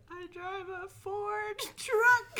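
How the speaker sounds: high, slow, wobbly near-tears whine; mock panicked whimper. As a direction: replay the panic as if about to cry. Retold panicked answer to cop.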